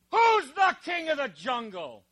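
high, put-on bully lion voice; preacher's joke lion challenging the monkey; bossy lion. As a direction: demand it like a swaggering lion.

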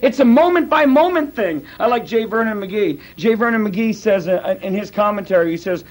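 ordinary delivery

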